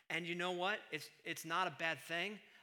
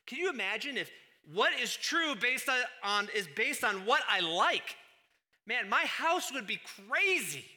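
raised preaching voice; 6 semitones higher, 8 dB louder than usual. Acted. Preacher mocks liking as truth test.

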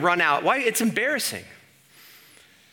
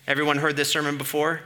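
ordinary talk

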